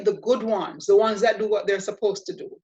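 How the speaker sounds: ordinary delivery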